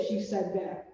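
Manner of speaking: plain reading voice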